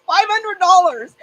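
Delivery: high, loud, drawn-out "hundred dollars"; slow, squealing sales pitch; excited pitch. Retold or imitated. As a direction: hype the price to the husband. Selling husband on the puppy.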